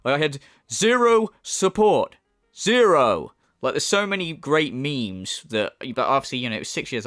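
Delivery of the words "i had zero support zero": emphatic repeat of "zero"; hammed-up complaining quote; mocking catchphrase impression. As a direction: hit each "zero" with mock indignation. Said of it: "when he says zero, he says it twice".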